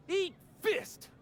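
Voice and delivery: high-pitched fighting yell; angry battle cry. Acted. Rival starts a fight over salvage, angry yell. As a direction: throw the words like a punch.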